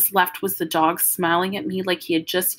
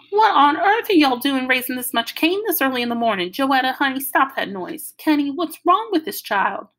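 fussing grandmother; 7 semitones higher, 4 dB louder than usual. Acted. Grandmother scolding noise.

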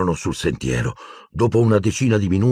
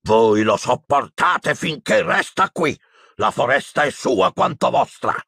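shouting Hagrid; 8 semitones higher than usual. A narrator's reading. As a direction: yell back defiantly, pitched high. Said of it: "urlò". (urlo indignato e acuto)

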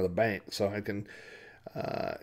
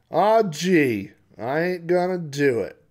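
loud, higher cowboy protest; whiny refusal; 7 semitones higher, 9 dB louder, slower than usual. Acted. Rifle owner refuses the sheriff, loudly whining. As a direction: refuse with a big put-upon complaint.

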